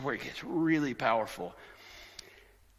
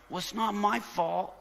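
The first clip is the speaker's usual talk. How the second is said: put-on high-pitched defensive kid voice; mock kid dodging blame, pitched high; whiny blaming kid; 9 semitones higher than usual. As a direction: plead innocence like a child passing the blame. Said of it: "he tells our kids to blame everybody else".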